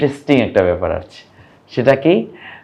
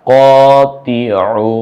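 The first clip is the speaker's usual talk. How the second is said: each word stretched long, syllables held, louder; slow drawn-out reading; 4 dB louder, much slower than usual. Shown as a demonstration. Teacher to class, reading the new words aloud, very slow. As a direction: read every word slowly and clearly. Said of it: "গলায় চাপ দিয়ে".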